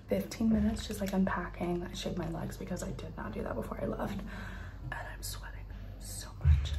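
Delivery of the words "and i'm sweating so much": whisper. Real, not acted. Whispering in the room.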